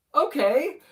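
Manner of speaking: high, rising, questioning okay; retold puzzled agreement that lifts right up; doubtful okay. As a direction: go along with it, unconvinced. Acts out own doubtful reply.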